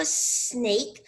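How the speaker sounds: "snake" opened on a long hissed s; long initial S. Shown as a demonstration. Teacher showing children how snake begins, long initial S. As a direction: hold the s before saying "snake". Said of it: "al principio hago el sonido de esta".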